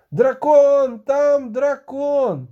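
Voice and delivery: high, loud, drawn-out alarm cry; alarmed shout. A narrator's reading. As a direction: scream the warning slowly across the distance. Shoemaker raises the alarm. (тривожний протяжний крик)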